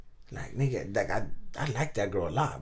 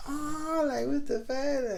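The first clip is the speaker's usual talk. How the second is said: much higher squealing girly mock voice, slow; mock cheerleaders; much slower than usual. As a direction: ham up the gasping cheerleaders. Cheerleaders react, voiced by teller.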